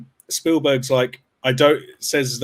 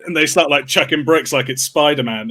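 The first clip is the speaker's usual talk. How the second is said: normal talking voice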